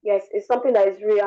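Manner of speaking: ordinary delivery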